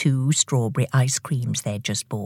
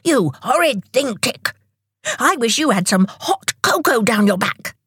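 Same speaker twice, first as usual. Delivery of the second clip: higher, indignant outburst; cross retort; 7 semitones higher than usual. A narrator's reading. Tock wishing cocoa on horrid Tick, indignant. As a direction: hit back angrily, pitched up.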